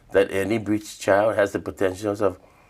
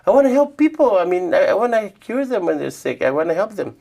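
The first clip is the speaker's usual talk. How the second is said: put-on high, loud eager kid voice; a young boy pleading to learn medicine, high and loud; eager child; 12 semitones higher, 5 dB louder than usual. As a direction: beg grandma like an excited little boy.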